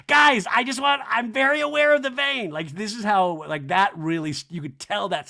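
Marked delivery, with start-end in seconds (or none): 0.1-2.5 high, loud hammed-up insistence; exaggerated high impression; mock defensive Kyle. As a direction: protest loudly in a high voice. Acting out Kyle defending her vein.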